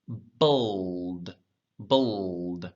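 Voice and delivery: "bold" alone, drawn out and low; syllable demonstration. Shown as a demonstration. Teacher breaks troubled into two syllables, drawn-out second syllable. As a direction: isolate "bold", lingering on the l before the d. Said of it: "So two syllables".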